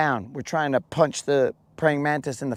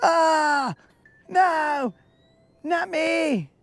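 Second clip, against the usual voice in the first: high, far-off scream of a tiny victim; high wailing pleas; distant bug screams; 13 semitones higher, much slower than usual. Pretend. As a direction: cry out in fear, far away. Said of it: "all we would hear would be".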